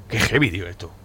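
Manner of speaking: ordinary talk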